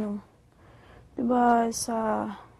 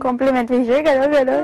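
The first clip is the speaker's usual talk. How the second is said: loud, giddy gush; excited squeal; 10 dB louder than usual. Real, not acted. Telling her friend about the compliment in a loud excited squeal. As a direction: burst out gushing about the compliment.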